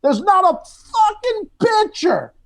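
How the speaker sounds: high, emphatic, slowing down; emphatic stand-up rant. Retold or imitated. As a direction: hammer it home from the stage. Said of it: "I go".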